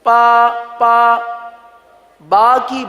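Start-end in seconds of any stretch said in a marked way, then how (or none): 0.0-1.2 "پا" stretched slowly with a p; slow demo of "پا" for "با"; wrong pronunciation. Shown as a demonstration. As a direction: drag out the mistaken "پا". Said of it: "با کو پا پڑھ دیا جاتا ہے".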